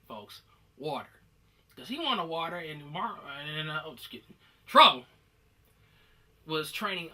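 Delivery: ordinary talk, nothing marked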